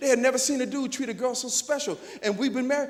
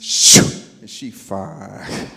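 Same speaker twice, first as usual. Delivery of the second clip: admiring; 12 semitones lower, 12 dB louder, much slower than usual. Acted. Admiring his fast wife.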